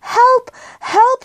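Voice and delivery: very high, panicked little bear; shouted cry for help. A narrator's reading. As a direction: call out high for rescue. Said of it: "shouted Paddington".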